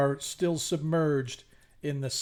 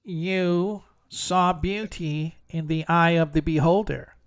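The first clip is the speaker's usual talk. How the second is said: sphinx pronouncement; 4 dB louder than usual. Acted. Sphinx tells the players what they saw, theatrical pronouncement. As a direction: pronounce it like a solemn riddle-keeper. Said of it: "As she looks and she says".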